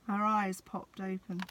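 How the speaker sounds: ordinary delivery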